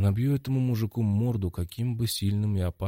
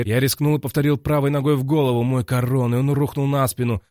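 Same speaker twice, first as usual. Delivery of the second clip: usual speaking voice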